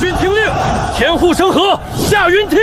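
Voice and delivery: bellowing battlefield orders; shouting over wind. Acted. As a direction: roar commands across the field.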